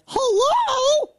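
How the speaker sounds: high, squeaky laughing hello; laughing squeal. Real, not acted. Mocking people who just noticed the label, laughing squeal. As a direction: break into a high giggle.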